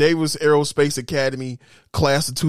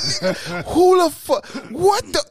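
shocked speechless; 11 semitones higher, slower than usual. Retold or imitated. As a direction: blurt broken questions in disbelief. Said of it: "such a state of a shock".

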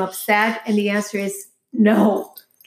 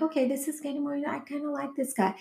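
softer, higher private musing; quiet inner thought; 5 semitones higher, 11 dB quieter, faster than usual. Retold or imitated. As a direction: think it to herself, softly. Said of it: "I'm like".

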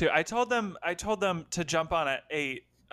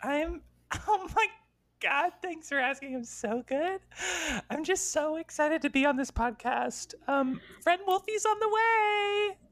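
put-on bright, higher girlish storybook voice; Little Red voice; 7 semitones higher than usual. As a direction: play a giddy fairy-tale girl. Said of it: "Little Red's joining".

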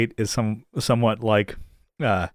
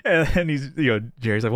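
ordinary talk, nothing marked

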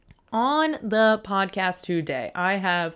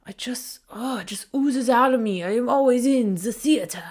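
breathy stage whisper, then a higher theatrical declaration; hammy theater voice; 5 semitones higher than usual. Pretend. Host mocking his own theater-kid habits, in a hammy stage voice. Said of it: "I was a theater kid".

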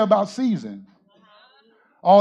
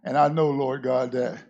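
much softer, lower prayer voice; 7 semitones lower, 6 dB quieter than usual. Acted. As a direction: pray quietly into the mic. Quiet prayer.

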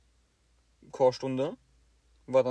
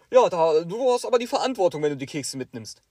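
scolding teacher; 3 semitones higher, 6 dB louder than usual. Retold or imitated. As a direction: tell him off about the crumbs. Teacher lecturing student.

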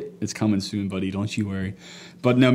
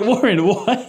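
higher, impatient retort, retold; mock exasperated reply; 7 semitones higher, much slower than usual. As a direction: act out being baffled by the giggling. Retells own reply to laughing brother.

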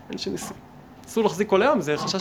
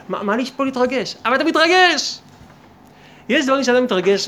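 high, rising mock protest; animated protest; 5 semitones higher, 6 dB louder than usual. Speaker challenges man denying his excitement over food, animated protest. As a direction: push back loudly, pitch leaping at the end.

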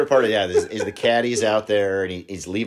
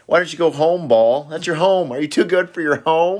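higher, scolding voice; hammed-up nagging at the ball; mock scolding ball; 8 semitones higher than usual. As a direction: chide the ball like a child. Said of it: "these sayings".

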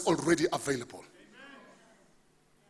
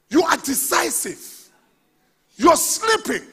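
loud, high, stretched-out sermon delivery; full-force preaching; 8 semitones higher, 11 dB louder, slower than usual. Real, not acted. Pastor proclaiming scripture to congregation, full-force preaching. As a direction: drive each phrase home, raised and drawn out.

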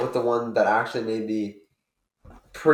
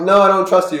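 loud retort, raised pitch; mock yell; 7 semitones higher, 9 dB louder than usual. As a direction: snap back loudly. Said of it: "I'm like".

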